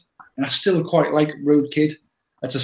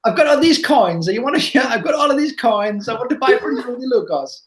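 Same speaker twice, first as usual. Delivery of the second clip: cartoon Italian plumber offering coins; Mario voice; 8 semitones higher than usual. Joking as Mario offering coins for the Lukos, hammed-up cartoon voice. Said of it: "Are you sure that's not Mario?".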